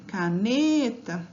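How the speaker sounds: "Caneta" slowly, with a long stressed NE; drawn-out "Caneta", weight on NE; calling the word out. Shown as a demonstration. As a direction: call "Caneta" as if summoning it, NE stretched. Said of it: "Chamei a palavra".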